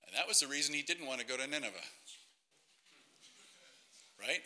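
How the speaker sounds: usual speaking voice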